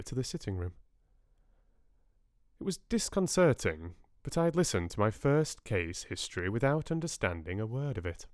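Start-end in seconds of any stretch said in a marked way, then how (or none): none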